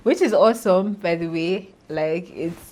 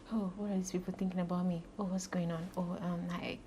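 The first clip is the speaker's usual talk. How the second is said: hushed, anxious thinking aloud; very soft, awkward self-talk; self-conscious inner voice; 2 semitones higher, 10 dB quieter than usual. Retold or imitated. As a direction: murmur it like a private worry. Acting out self-conscious inner thoughts.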